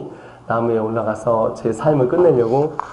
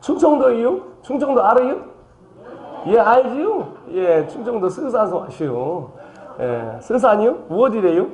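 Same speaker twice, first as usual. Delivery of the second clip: mock Chungcheong dialect; 9 semitones higher than usual. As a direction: play a Chungcheong country man. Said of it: "삼 번". (높은 목소리의 충청도 사투리 흉내)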